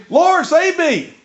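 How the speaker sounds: slow, high, loud cry for help; sinking man crying out, drawn out; desperate cry. Acted. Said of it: "cried out".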